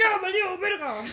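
high, loud, drawn-out laughing dread; comic wail of doom; mock panic. Student as teacher walks toward her, laughing mock panic.

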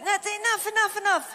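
high-pitched, mock begging to stop; overwhelmed protest. Believer overwhelmed by God's love, playful protest.

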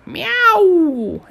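high, loud cat-goddess yowl; yelling meow. A narrator's reading. Senile cat goddess smashing demons, yelling a high loud meow. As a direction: screech it like a battling old cat.

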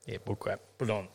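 soft, dry brush-off; dismissive official. Acted. Official answering a mask exemption claim, quiet dismissal. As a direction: dismiss it quietly, as the rule enforcer. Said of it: "I had to be that guy".

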